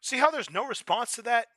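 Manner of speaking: high, loud, preacher-like outrage; heated rant. Real, not acted. Driving his point home to audience, loud outrage. Ranting at audience about prank.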